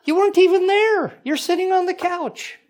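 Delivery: high, mocking disbelief; incredulous retort. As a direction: scoff at her claim of winning. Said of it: "And it's like".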